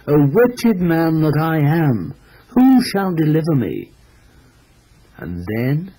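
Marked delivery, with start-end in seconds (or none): 0.1-3.9 cry of desperation. A narrator's reading. Preacher voicing the burdened man's cry, pleading. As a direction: read it as a restrained, raised plea. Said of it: "can bear it no longer and cries".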